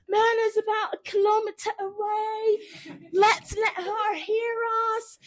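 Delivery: high, loud mock British announcer; rally the crowd like a British race commentator. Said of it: "the announcer at the bottom, Scott Davis, a Brit".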